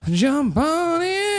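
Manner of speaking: very high, slow pony voice. Pretend. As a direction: squeak it slowly like a toy pony. Pony voice bit.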